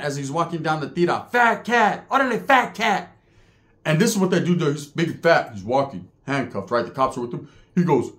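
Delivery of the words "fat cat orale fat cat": high sing-out taunt; imitated jeering call. Everyone hails him walking down the tier, jeering call. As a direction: call the nickname out like a heckler. Whole tier calls the nickname.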